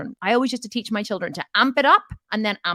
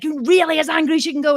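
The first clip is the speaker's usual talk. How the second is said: pushing hard; 8 semitones higher, 6 dB louder than usual. Retold or imitated. Coach pushing client to peak.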